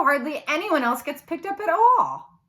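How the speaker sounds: ordinary delivery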